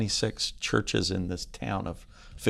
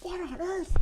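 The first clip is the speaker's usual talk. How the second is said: very high, soft falsetto disbelief; hushed, squeaky astonishment; mock bewilderment; 9 dB quieter than usual. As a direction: play her baffled, way up high. Wife's baffled reaction, acted.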